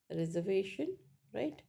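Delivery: normal talking voice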